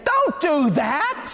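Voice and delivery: a squeaky, scandalised inner voice; inner protest. Retold or imitated. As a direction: shriek it in your head, high and exasperated.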